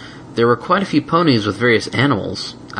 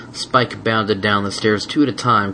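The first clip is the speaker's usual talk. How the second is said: ordinary delivery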